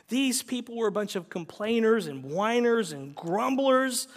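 usual speaking voice

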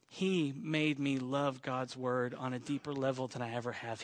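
low, soft and earnest; heartfelt tribute. Real, not acted. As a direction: speak tenderly, with quiet sincerity.

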